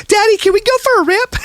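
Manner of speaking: put-on eager kid asking Daddy sweetly; imitating daughter. Dad imitating his young daughter asking for a scooter ride, high child voice. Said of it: "She's like".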